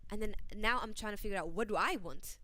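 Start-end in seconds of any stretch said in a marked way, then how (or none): none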